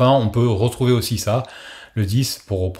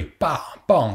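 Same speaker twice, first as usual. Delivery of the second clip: short, higher-pitched "Pa, pa"; mock pistol shots; 5 semitones higher than usual. Shown as a demonstration. Teacher showing a pistol memory trick, mock gunshots. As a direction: lightly imitate pistol shots with the syllable. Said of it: "comme un pistolet".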